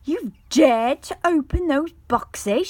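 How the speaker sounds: wizard shouting, outraged and loud; angry shout. A narrator's reading. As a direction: roar at him for opening the boxes. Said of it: "shouted the other angrily".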